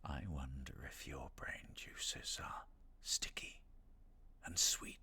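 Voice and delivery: slow, soft, voiceless whisper; creepy character whisper. Pretend. Streamer performing her game cameo for chat, slow whisper. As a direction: savour each word like a predator. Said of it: "Isn't it marvellous?".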